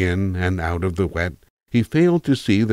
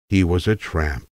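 ordinary delivery, nothing marked

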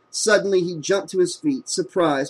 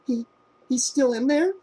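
bewildered; 10 semitones higher than usual. A narrator's reading. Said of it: "feeling totally bewildered".

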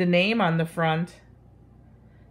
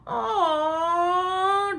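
high, sung-out whimpering aw; crying groan; 11 semitones higher than usual. Acted. Splat outdone again, high whimpering groan. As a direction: wail it like a sad kitten. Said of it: "groaned Splat".

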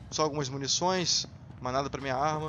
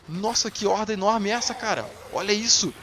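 loud, high-pitched astonishment; amazed exclamation; 6 semitones higher, 6 dB louder than usual. Real, not acted. Gamer sees an enormous zombie horde, amazed exclamation.

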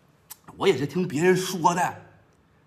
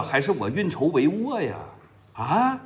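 usual speaking voice

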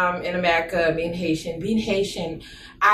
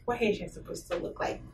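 much softer, breathy disbelief; whispered exasperation; 13 dB quieter, faster than usual. Real, not acted. Repeating the insult she keeps hearing, whispering in exasperation. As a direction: whisper it, fed up.

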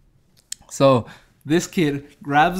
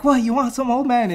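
high-pitched goading jeer; mock taunting challenge; 9 semitones higher than usual. Fighter taunts the old man, high mocking challenge. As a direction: pitch it up high and provoke him.

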